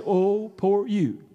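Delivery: soft, slow, drawn-out pity; mock pity. Preacher voicing God joining a pity party, mock pity. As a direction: coo it with exaggerated sympathy. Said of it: "when you're having a pity party".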